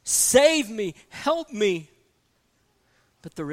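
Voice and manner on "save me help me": cry for help. Acted. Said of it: "this person cries out to it".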